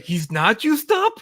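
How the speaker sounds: high, slow mock disbelief; incredulous reaction. Reader retelling his shock at the comic, high incredulous question. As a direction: stretch the question upward in disbelief.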